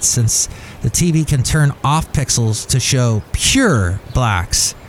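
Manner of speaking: normal talking voice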